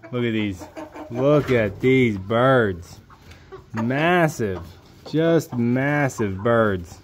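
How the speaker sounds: drawn-out, awed words; slow awed emphasis. Real, not acted. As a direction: linger on each word in awe. Marvelling at big birds.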